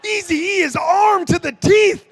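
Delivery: high, loud panicked yell; a loud, high, startled cry of alarm; alarmed shout. Retold or imitated. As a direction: shout like you just saw a trunk full of guns. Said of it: "And I was like".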